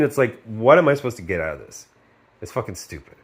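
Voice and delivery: usual speaking voice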